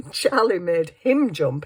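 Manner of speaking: usual speaking voice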